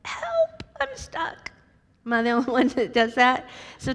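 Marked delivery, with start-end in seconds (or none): 0.0-1.5 squeaky high cry for help, stuck in a dress; tiny high voice pleading for help; mock panicked squeal. Stuck in a tight outfit, squealing for help. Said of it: "you're like".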